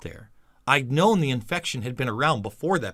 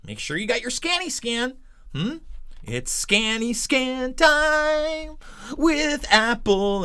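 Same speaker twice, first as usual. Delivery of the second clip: higher, sing-song mockery of scanny scan; mocking baby talk; 7 semitones higher than usual. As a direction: tease it like talking to a toddler.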